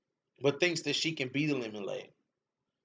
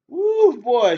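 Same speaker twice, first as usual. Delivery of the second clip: very high, loud whoop; loud, high whoop of delight; excited whoop; 9 semitones higher, 11 dB louder than usual. Real, not acted. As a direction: let out a loud hoot. Whooping at the knockout memory.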